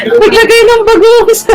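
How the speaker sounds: high-pitched laugh through words. Real, not acted. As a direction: laugh while you say it. Laughing at the shrimp paste.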